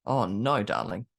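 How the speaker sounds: acted low, indulgent correction; retold knowing reproof. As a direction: let an adult down a teenager gently, pitch dropping. Adult's knowing reply to teenager.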